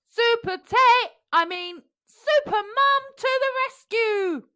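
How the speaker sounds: high, slow, loud superhero cry; heroic announcement. A narrator's reading. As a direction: announce the rescue grandly. Heroic entrance.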